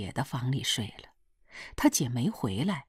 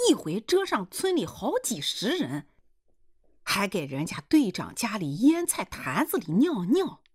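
higher-pitched dialect grumbling tirade; indignant village mother; 5 semitones higher than usual. Acted. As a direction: reel off his misdeeds in indignant dialect. Mother retorts, listing uncle's misdeeds.

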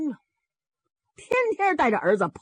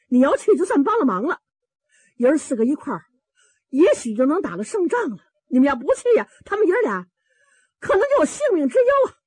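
high, loud pleading build to a drawn cry; pressing, high-pitched urging; urgent plea; 6 semitones higher, 4 dB louder, faster than usual. Acted. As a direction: raise the voice higher and higher as you beg for help. Urging them to help in rescue.

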